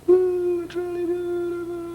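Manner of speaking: very high, soft, breathy-free sigh of awe; high awed falsetto. Acted. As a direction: coo it high in wonder. Character admires the flip.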